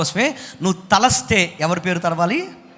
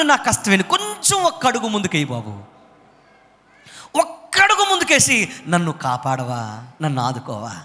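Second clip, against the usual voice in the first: fervent plea; 5 semitones higher than usual. Acted. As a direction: beg God to come, voice falling away. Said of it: "ఏం కోరుకున్నాడు?". (ఉద్వేగంతో వేడుకోలు, చివరికి మెల్లగా)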